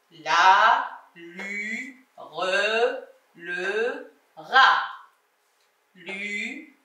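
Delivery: drawn-out low syllables, each gliding upward; low, stretched teacher's reading drill. Shown as a demonstration. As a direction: chant each syllable slowly, last in each group short.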